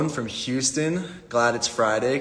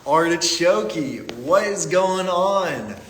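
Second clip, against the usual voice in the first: slow, sing-song hello, pitched up; playful greeting; 4 semitones higher, slower than usual. Real, not acted. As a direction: stretch it out like a goofy rhyme to open the show. Playful stream opening greeting.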